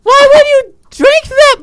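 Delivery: high, loud disgusted cry; loud disgusted shout. Acted. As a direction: yell it in shock. Gnome spits drink everywhere.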